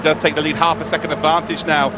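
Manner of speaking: usual speaking voice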